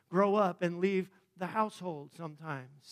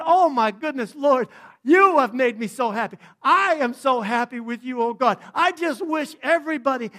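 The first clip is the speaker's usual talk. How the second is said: loud, high joyful prayer; 7 semitones higher, 17 dB louder than usual. Pretend. Believer praying to God, loud joyful prayer. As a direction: pray out loud with overflowing joy.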